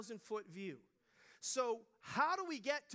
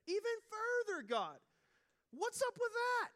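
exasperated plea; 10 semitones higher, 5 dB louder than usual. Retold or imitated. Pleading with God in a retold prayer.

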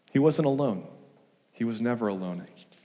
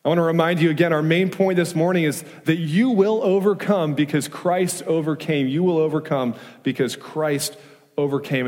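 louder, higher, rousing pulpit voice; preaching at full force; 5 semitones higher, 4 dB louder than usual. Acted. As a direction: drive the main point home to the congregation. Preacher stresses main point.

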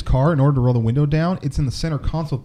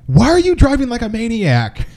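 higher-pitched mock alarm at a driver; raised-pitch, exasperated "what are you doing" quote; retold indignant protest; 8 semitones higher, 4 dB louder than usual. Passenger scolding a reckless driver, indignant.

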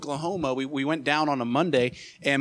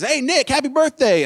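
imitating an old man calling across the room, much higher and louder; 12 semitones higher, 8 dB louder than usual. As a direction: shout the greeting across the restaurant. Old diner calls out birthday greeting.

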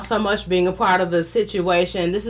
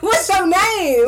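high, loud, drawn-out shout with laughter; hyped-up call-out; 10 semitones higher, 5 dB louder, slower than usual. Pretend. Host cues the man in the back, hyped-up call-out. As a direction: holler it slow and high like a hype call.

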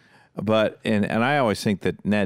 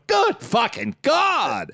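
appalled crew; much slower than usual. Retold or imitated. The camera crew watching, slow and appalled. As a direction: drag it out in disgust. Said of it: "the camera crew's going".

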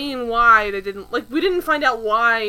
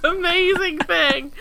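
high, loud real laughter through the words; cracking up; 7 semitones higher, 11 dB louder, slower than usual. Real, not acted. Co-host reacting to a ridiculous TV scene, cracking up. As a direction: barely get it out through laughing.

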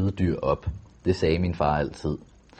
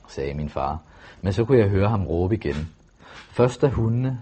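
ordinary delivery

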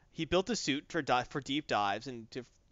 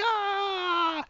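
mock scream. Avengers blasted by pressure wave.

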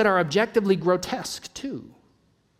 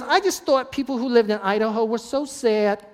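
higher-pitched imitation of a New York woman; mock New York accent, pitched up; 5 semitones higher than usual. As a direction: do a hammy New York lady. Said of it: "That's my New York".

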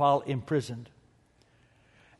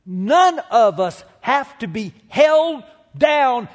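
loud, high-pitched build over the music; rising sermon climax, full voice; preaching at full force; 7 semitones higher, 9 dB louder than usual. Acted. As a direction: lift the room, climbing louder.

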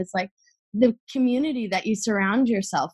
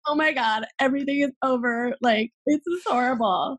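higher, melodramatic wail of despair; mock meltdown; 5 semitones higher than usual. To her friend, retelling her own freak-outs, mock despairing. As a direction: ham up the panic like it's the end of the world.

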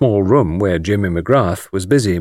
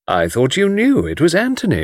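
ordinary delivery, nothing marked

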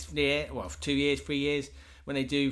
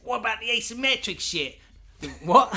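much higher, louder retort; mock patient; 9 semitones higher, 6 dB louder than usual. Patient quizzing Doc Smith, much higher. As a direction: fire a cheeky question.

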